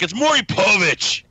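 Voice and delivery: high, loud quoted correction; exasperated outburst. Retold or imitated. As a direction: shout the name as an indignant correction. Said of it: "I'm like".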